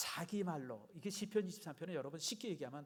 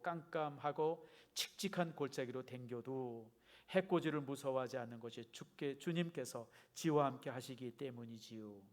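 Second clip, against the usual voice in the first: put-on Chungcheong accent, endings stretched out slowly; Chungcheong dialect. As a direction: read like a Chungcheong local, lingering on each ending. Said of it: "충청도 버전은 이렇습니다". (충청도 사투리로)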